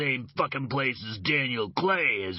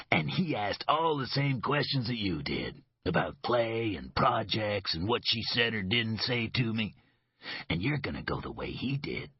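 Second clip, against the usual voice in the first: bitter grin. Acted. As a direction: sneer the secret, then drop into menace. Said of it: "A broad, bitter grin".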